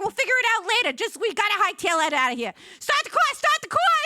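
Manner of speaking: high loud hurry; getaway panic. Retold or imitated. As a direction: shout it like fleeing robbers. Getaway urgency.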